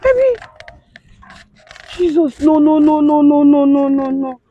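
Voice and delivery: high, horrified cries of no; shocked wail. Acted. Wife finding her husband dead, horrified wail. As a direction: panic breaking out as she realises.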